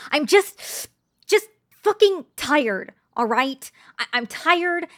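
exploding in rage, then pushing through the rest loud; furious shout. Acted. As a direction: yell it out, losing her temper. Shouting she's not angry.

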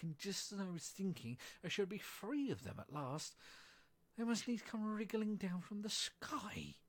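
high, rising wail, slowing at the end; rising shriek. A narrator's reading. Pigeon rails about serpents invading its tree, shrieking. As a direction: build towards an exasperated shriek. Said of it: "raising its voice to a shriek".

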